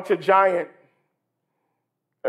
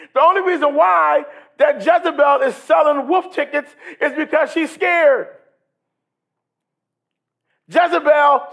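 full-force preaching; 5 semitones higher than usual. Acted. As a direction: preach it hard to the church. Preaching climax.

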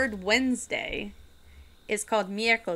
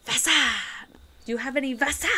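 Wasser said with a V, bad German accent; mock German accent; 4 semitones higher, much faster than usual. Vlogger asking supermarket staff for water, mock German accent. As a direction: ask for Wasser like a learner in a German shop. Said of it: "but the way he said it was".

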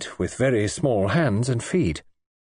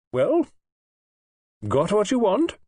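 put-on high, wry old-lady query; dry old lady; 10 semitones higher than usual. A narrator's reading. Old lady peering at Audrey and teasing her. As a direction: needle the young woman a little.

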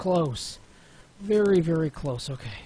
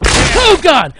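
much higher and louder, a sudden yell of shock; real startled shriek; shocked scream; 8 semitones higher, 15 dB louder than usual. Real, not acted. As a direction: scream it as everything blows up. Character explodes on landmine.

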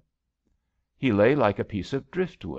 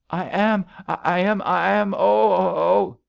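high, loud, stammering wail; panicked insistence; 11 semitones higher, 7 dB louder than usual. A narrator's reading. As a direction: wail it as panic takes over. Drowning woman insists, panicking.

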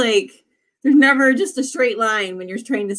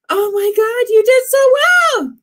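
thrilled praise; 13 semitones higher, 5 dB louder than usual. Real, not acted. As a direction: rave about their result. Praising a viewer's result.

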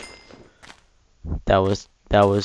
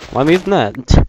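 higher, louder incredulous yell; exasperated shout; 7 semitones higher, 7 dB louder than usual. Real, not acted. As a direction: shout it in disbelief. Repeats louder.

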